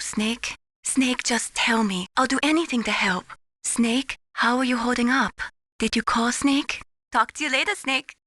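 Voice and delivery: ordinary talk, nothing marked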